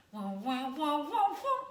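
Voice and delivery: "wah" pushed into the nose, sung rising; nasal wah demo. Shown as a demonstration. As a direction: let "wah" go into the back of the nose. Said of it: "which would sound like this".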